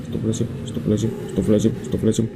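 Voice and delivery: frightened rapid prayer. Real, not acted. Scared gamer going downstairs, fast hushed prayer. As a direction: rattle off the prayer under your breath in fear.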